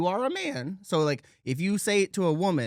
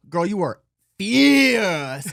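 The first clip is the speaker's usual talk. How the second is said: much louder, slow and stretched sassy praise; full sassy rendition; 3 semitones higher, 9 dB louder, much slower than usual. Retold or imitated. Praising a toned girl on Sixth Street, a loud slow diva drawl. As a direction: snap and dip through it like a diva. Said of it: "you did that whole rendition with inflection".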